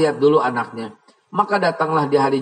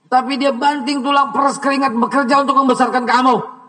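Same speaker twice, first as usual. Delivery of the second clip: fiery preaching; 6 semitones higher than usual. Acted. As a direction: thunder at the ungrateful son. Preaching at full force.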